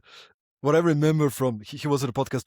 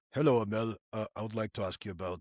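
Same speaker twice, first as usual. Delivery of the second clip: low, soft, mock-formal host voice; stiff, serious interviewer; 8 semitones lower, 8 dB quieter than usual. Host showing co-host a no-fun podcast opening, mock-serious. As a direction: play a dull host, hushed and humourless.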